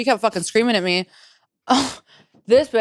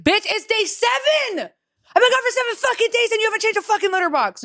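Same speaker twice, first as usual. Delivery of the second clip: high-pitched exasperated tirade; angry outburst; 13 semitones higher than usual. Retold or imitated. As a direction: retell it like losing your temper on the phone. Retold angry phone confrontation.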